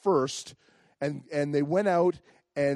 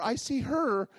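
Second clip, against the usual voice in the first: ordinary talk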